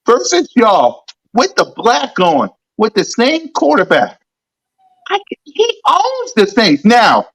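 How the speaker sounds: ordinary talk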